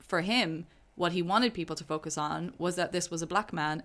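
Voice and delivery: usual speaking voice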